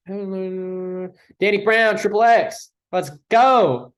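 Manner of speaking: loud, hyped-up shout of the album name; excited hype. Real, not acted. Vlogger hyping up his top-ranked album, loud excited shout. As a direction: cheer it out like your favorite just came up.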